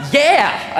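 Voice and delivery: high, loud cheering yeah; eager agreement. Acted. Friend agreeing to a taco run, eager. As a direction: whoop it like an eager pal. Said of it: "another says".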